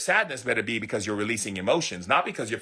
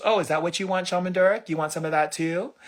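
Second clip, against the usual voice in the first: lightly acted universe, higher pitch; the universe answering; 6 semitones higher than usual. The universe offering more misery, higher acted voice.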